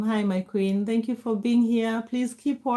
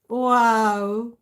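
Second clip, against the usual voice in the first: ordinary delivery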